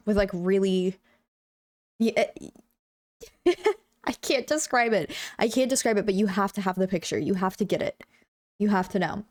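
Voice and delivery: ordinary delivery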